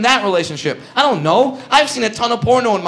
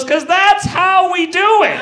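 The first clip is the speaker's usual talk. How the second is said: slow, higher, cocky quote; proud reply; 11 semitones higher, slower than usual. Retold or imitated. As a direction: deliver it like a proud swaggering line. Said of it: "he looks up at me and goes".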